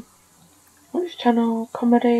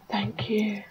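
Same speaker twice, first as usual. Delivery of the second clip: whispered thanks; 2 semitones lower, 10 dB quieter than usual. Real, not acted. Thanking a sim quietly.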